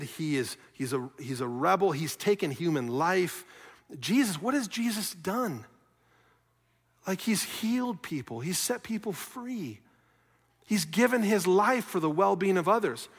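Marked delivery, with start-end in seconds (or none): none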